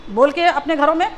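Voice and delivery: usual speaking voice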